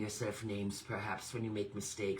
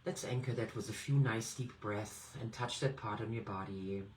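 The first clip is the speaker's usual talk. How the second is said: guided meditation; 3 semitones lower, 5 dB quieter than usual. Acted. Meditation coach guiding listeners through breathing, soft and calm. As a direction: lead them gently into the breath.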